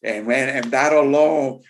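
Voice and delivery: normal talking voice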